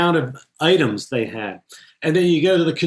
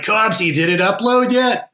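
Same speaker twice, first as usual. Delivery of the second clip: higher-pitched mock Boston call; attempted Boston accent; 6 semitones higher than usual. Editor calling to the video assist guy, attempted Boston accent. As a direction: call out in your best amateur Boston accent.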